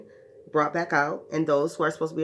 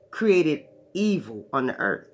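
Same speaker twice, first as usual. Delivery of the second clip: normal talking voice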